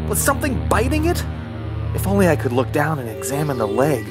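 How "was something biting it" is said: high, quick panicked question; alarmed. Acted.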